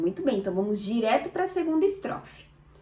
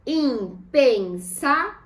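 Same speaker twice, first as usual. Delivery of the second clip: IM, PEN, SÁ, VEL, slow and spaced; "Impensável" as im... pen... sá... vel; syllable by syllable; 2 semitones higher, 4 dB louder, much slower than usual. Shown as a demonstration. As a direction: break "Impensável" into four separate syllables. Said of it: "Vamos separar em sílabas".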